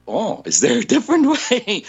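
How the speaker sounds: high-pitched, laughing mock surprise; innocent surprise. Retelling a reply to Missus Pacheco, amused mock surprise. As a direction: act out a clueless question, laughter breaking in.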